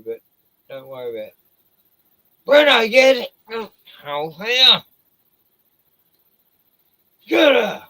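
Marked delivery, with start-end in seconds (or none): 2.5-4.8 loud, high shout at the dog; yelling at the dog. Real, not acted. Urging dog on. 7.3-7.8 loud, high shout at the dog; yelling at the dog. Real, not acted. Urging dog on.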